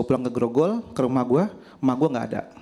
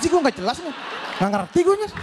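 high, baffled complaint; exasperated confusion; 11 semitones higher than usual. Real, not acted. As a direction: throw up your hands at her logic. (bingung dan kesal dengan suara tinggi)

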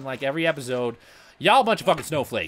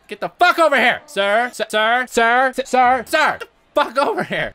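loud, raised, insistent hollering; shouting after opponent; 6 semitones higher, 9 dB louder than usual. Pretend. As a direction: yell repeatedly to summon someone back. Yelling at fleeing enemy.

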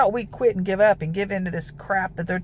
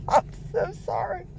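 a higher, giggly sorry; real laughing sorry after her own joke; laughing apology; 12 semitones higher, 5 dB quieter than usual. Real, not acted. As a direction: apologize while still laughing.

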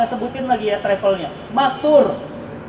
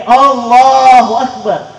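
slow loud exclamation; 8 dB louder, much slower than usual. Real, not acted. Preacher exclaims at tragedy.

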